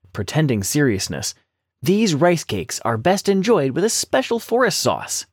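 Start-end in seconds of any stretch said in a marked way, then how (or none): 1.8-5.3 lightly raised, sly little rabbit voice; clever little rabbit. A narrator's reading. Rabbit sweet-talks the hungry tiger, higher and sly. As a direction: coax the tiger, politely cunning.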